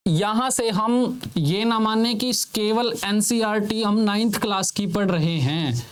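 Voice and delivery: ordinary talk, nothing marked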